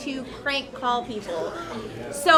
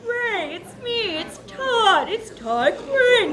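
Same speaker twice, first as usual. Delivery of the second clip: higher put-on voice, last word drawn out; prank-call kid voice; 5 semitones higher, slower than usual. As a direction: prank your friend in an imitated kid's voice. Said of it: "that talked like that".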